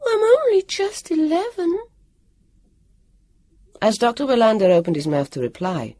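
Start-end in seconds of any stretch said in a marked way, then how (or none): 0.0-1.8 put-on high, sugary little-girl voice; a high put-on childish plea; little lisping voice. A narrator's reading. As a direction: play the innocent little girl, pleading. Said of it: "in a little lisping voice".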